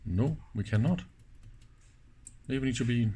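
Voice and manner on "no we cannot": ordinary delivery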